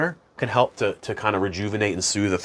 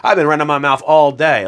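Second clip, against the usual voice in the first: mock self-scolding; 5 semitones higher, 7 dB louder than usual.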